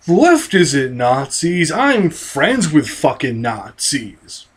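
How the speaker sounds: low, slow sarcastic mockery of a right-winger. Vlogger mocking right-wingers' denial, low slow sneer. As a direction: drag it out low, sneering at their excuse. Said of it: "Take a look around you and go".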